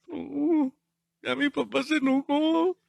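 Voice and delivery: put-on small wary child's voice; acted child noticing dad is cross; worried child. Acts child reading father's look.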